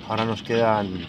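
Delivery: ordinary talk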